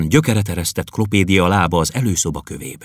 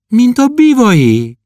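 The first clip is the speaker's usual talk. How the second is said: put-on high, slow, louder girlish comfort; 7 dB louder, slower than usual. Klopédia comforting the poet, high and slow. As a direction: console him like a child would. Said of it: "vigasztalta Klopédia".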